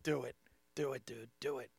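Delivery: hushed self-dare under the breath; egging himself on. Retold or imitated. Comic retelling his inner dare to himself, hushed urging. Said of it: "I'm like".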